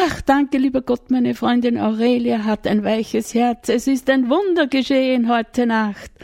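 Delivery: hoch und laut geflötet zum Himmel; flöte den Dank fröhlich nach oben; flötend. A narrator's reading. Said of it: "flötete Bartholomäus in den Himmel". (hoch geflötet in den Himmel)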